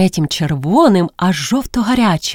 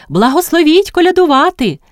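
high, louder call from outside; carollers' call; 10 semitones higher, 4 dB louder than usual. A narrator's reading. Carollers asking at a house to sing, calling out. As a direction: call up to the window in a high, ringing voice.

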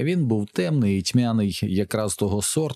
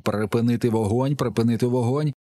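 mild narrated yell; 4 semitones higher than usual. A narrator's reading. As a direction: call out a repeated order, restrained. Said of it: "Хтось з іншого боку волав". (стримано зображений крик)

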